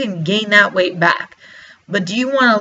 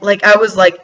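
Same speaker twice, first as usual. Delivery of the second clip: normal talking voice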